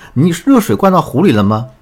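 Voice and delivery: imitating wife snapping back at husband; mock loud retort. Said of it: "我就大声跟他说".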